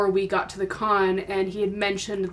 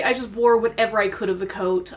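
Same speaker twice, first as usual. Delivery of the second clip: ordinary talk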